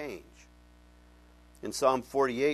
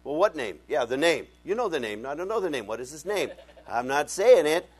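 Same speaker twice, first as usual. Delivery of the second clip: mock bickering orthodox voices; 3 semitones higher, 7 dB louder than usual. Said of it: "they'll say".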